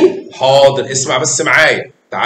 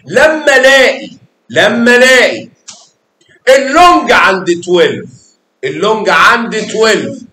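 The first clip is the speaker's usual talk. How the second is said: loud, emphatic rule for the class; emphatic teaching; 6 semitones higher, 5 dB louder than usual. Shown as a demonstration. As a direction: raise the voice to make the rule stick. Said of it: "teacher emphasizing rule".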